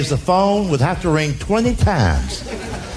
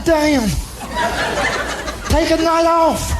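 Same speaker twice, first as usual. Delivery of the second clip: exasperated retort; 7 semitones higher, slower than usual. Retold or imitated. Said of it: "I'm going".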